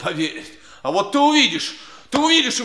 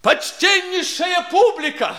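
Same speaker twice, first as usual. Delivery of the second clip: booming circus ringmaster; 8 semitones higher, 5 dB louder, much slower than usual. Acted. Addresses the crowd for help.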